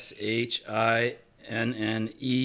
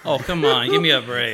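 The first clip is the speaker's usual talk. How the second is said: loud, half-laughing plea; exasperated protest; 7 dB louder than usual. Real, not acted. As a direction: complain about an unfair ruling. Pleads against losing the hole.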